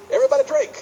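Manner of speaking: high, loud party-grandma cry; flamboyant grandma voice. Retold or imitated. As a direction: call it out like a tipsy grandma. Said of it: "her solution ... is".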